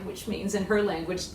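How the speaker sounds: ordinary delivery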